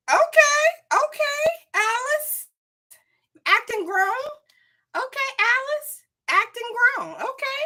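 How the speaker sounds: very high, squealing mock-scandalised voice; shocked sassy reaction. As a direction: squeal it like a gossiping friend catching someone out. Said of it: "the red herring was like".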